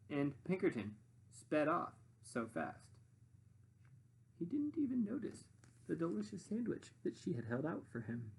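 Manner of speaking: plain reading voice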